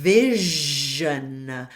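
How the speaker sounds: vision with a long buzzing zh in the middle; buzzy zh sound. Shown as a demonstration. Diction coach models vision with a buzzy zh. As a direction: lean into the zh of vision. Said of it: "So it's a zh sound".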